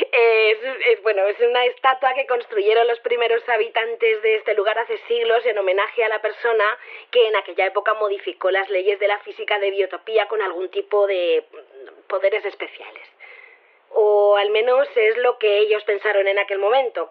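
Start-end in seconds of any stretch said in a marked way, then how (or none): none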